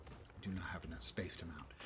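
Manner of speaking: whispered aside; whispering. Real, not acted. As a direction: breathe it out unvoiced. Muttered aside.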